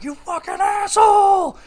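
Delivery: hammed-up screaming fit, out of nowhere; over-the-top outburst. Rival cop suddenly yelling at Jaden, over-the-top shout. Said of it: "so over the top out of nowhere".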